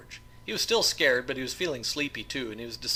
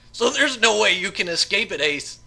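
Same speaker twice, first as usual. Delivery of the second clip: evil gloating; 9 semitones higher, 9 dB louder than usual. Acted. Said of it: "laughed like an evil spirit".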